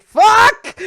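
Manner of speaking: sudden high, loud curse; shouted curse. Acted. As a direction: yell it out as the spell fails. GM voices the caster's reaction.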